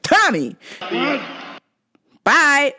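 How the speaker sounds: short high-pitched yelled name; mock yelling customer. Customer demanding his order by name, mock yelling. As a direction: yell it like an oddball customer. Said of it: "and it's loud".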